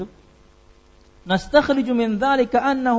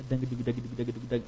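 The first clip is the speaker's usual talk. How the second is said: imitated drumming; 7 semitones lower, 8 dB quieter, much faster than usual. Imitating drum beats.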